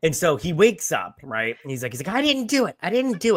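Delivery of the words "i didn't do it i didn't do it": high-pitched mock panic; panicked denial. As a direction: act out waking up and blurting excuses. Sleeper jolts awake, denies blame.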